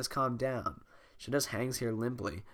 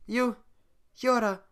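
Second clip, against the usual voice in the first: put-on Scottish accent; 11 semitones higher, 6 dB louder than usual. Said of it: "made Fiona have a Scottish accent".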